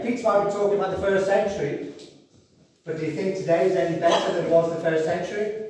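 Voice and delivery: normal talking voice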